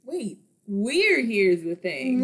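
slow, loud, laughing realization; mock surprise. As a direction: act like it just dawned on you. Mock discovery in podcast skit.

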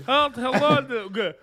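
Russian babushka. Acted. Friend asked for an impression, mock Russian babushka voice. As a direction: greet like a hammed-up Russian babushka. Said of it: "impression of a Russian babushka".

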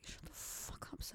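breathy whisper under the breath; whispered swear. Retold or imitated. Thinking it at the teacher, whispered under breath. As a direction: hiss it so the teacher can't hear.

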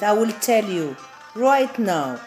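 usual speaking voice

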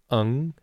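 "eng" with the standard e before ng; "eng" said the standard Mandarin way; standard eng ending. Shown as a demonstration. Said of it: "they would say".

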